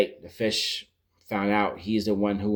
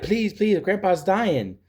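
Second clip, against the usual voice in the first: pleading bystander; 10 semitones higher, slower than usual. Retold or imitated. Bystanders beg again.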